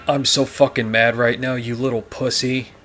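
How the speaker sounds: normal talking voice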